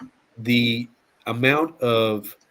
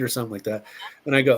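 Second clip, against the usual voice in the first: usual speaking voice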